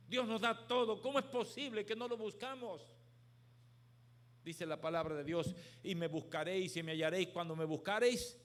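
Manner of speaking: loud, high, forceful preacher voice; fiery preaching. Acted. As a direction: preach it out to the whole crowd.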